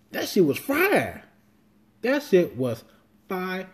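high-pitched, insistent praise; emphatic rave. Retold or imitated. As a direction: protest it in a high, hyped voice. Protests dad's lukewarm verdict on Logan.

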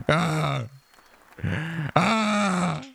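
rising cries of a hurt fighter. Acted. Killington on his go after the mockery, rising wordless cries.

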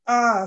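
short high loud scream; frightened cry of alarm. A narrator's reading. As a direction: fairies screaming as they take cover.

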